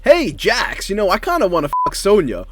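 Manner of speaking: brash action hero line; Johnny Cage voice. Retold or imitated. Acted Mortal Kombat cutscene.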